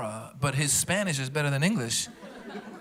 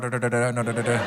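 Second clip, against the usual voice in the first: Indian accent; 5 semitones lower than usual. Shown as a demonstration. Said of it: "she doesn't do the hard".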